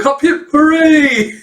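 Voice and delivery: slow, very high, loud hooray; mock cheer. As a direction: drag out a hammed-up celebration. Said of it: "He's like".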